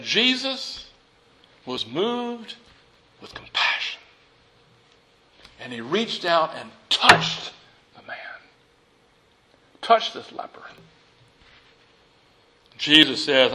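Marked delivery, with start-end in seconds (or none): 0.0-10.6 hushed. Real, not acted. Preacher to congregation on Jesus touching the leper, hushed. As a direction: drop to a reverent hush. Awed hush over Jesus' compassion.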